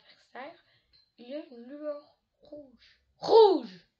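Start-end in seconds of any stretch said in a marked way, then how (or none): 3.2-3.9 crié fort, plus aigu; cri soudain. A narrator's reading. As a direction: lance le mot comme un cri pour faire sursauter. Moment de frayeur dans l'histoire. (crie « Rouge! » pour faire peur aux auditeurs)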